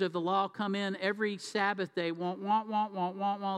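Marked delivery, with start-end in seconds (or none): 2.1-3.6 droning wah-wah imitation; Charlie Brown's teacher. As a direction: lightly mimic a droning cartoon teacher. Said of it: "like Charlie Brown's teacher".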